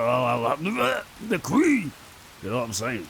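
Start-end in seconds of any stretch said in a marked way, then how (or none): 0.0-1.9 slow, rising to a high squeak on queen; a lightly voiced drunk toasting the queen; put-on drunk voice. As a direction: ham up a tipsy royal fan, slowly. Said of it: "you can do the voice always".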